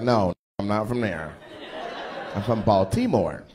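low, slowed mock of the audience guy insisting. Comic mocks the guy claiming Baltimore, low and slow. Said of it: "He was like".

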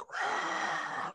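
long breathy crowd noise; imitated crowd roar. Storyteller shows the din of the crowd, breathy roar. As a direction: mimic a distant crowd with breath alone.

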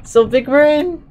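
high, loud, gloating; mock boast. Real, not acted. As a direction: brag like a cartoon genius.

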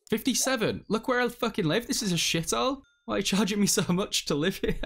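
high-pitched, exasperated protest; indignant outrage. Real, not acted. Player protesting a big bill for a shithole, indignant. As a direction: complain in a high, incredulous pitch.

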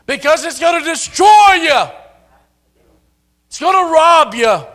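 high, loud, hammering sermon; building to a shouted climax; full-force preaching. Acted. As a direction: preach it hard to the congregation.

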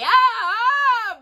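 high pained cry; witch howling. Acted. Witch shoved into the oven, dreadful howl. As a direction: howl as the oven door slams. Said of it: "began to howl dreadfully".

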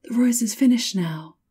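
high, loud announcement; triumphant cry. A narrator's reading. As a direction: cry out the good news as the tree. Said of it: "cried the tree".

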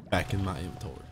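normal talking voice

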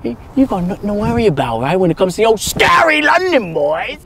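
high, loud ranting; agitated, strained high-pitched tirade; scornful shouting rant. Acted. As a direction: rant back with mocking scorn. Ranting back after death threat.

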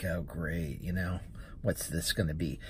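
low, soft grumble to himself; under-the-breath "here we go" dread; retold wary sarcasm. Driver to himself as woman directs him, wary sarcasm. Retells his dread on arriving.

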